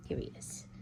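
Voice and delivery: unvoiced soft whisper. Real, not acted. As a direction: whisper it near the dog. Showing dog, whisper.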